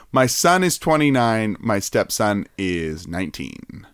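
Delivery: ordinary delivery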